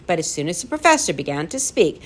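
plain reading voice

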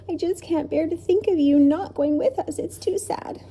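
high, tearful plea, fading at the end; acted sobbing. A narrator's reading. Lindy upset the professor cannot come, sobbing. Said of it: "she sobbed".

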